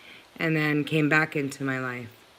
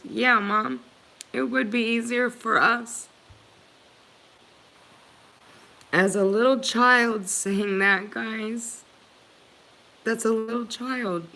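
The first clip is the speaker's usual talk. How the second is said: higher voice, holding back real emotion; getting emotional; 4 semitones higher than usual. Real, not acted. Mother tells viewers her child's painful answer, getting emotional. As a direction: struggle to keep composure while recalling it. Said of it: "I'm sorry I'm getting emotional".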